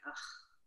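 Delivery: breathy unvoiced ugh; quiet voiceless retold groan; mock disgusted groan. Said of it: "And I was like".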